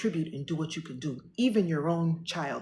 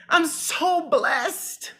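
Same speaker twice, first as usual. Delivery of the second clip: very slow, higher, through a laugh; laughing gratitude; 9 semitones higher, 4 dB louder, much slower than usual. Real, not acted. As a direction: laugh it out, overwhelmed.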